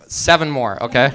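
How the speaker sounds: ordinary talk, nothing marked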